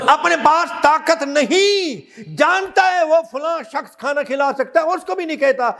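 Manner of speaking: high, ringing sermon delivery; forceful preaching. Real, not acted. Preacher admonishing the congregation about feeding the hungry, forceful preaching. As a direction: preach it hard, pitch held up high.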